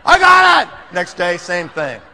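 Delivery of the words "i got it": pumped up again. Retold or imitated. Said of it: "All of a sudden".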